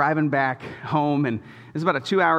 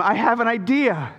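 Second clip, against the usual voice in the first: put-on little girl eager; 6 semitones higher than usual. Daughter voiced in story.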